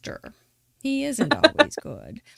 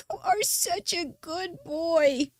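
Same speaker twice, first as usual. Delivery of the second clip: higher, slow praise for a dog; talking to the dog; 6 semitones higher, 3 dB quieter, slower than usual. Retold or imitated. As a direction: coo it slowly to the dog, higher up.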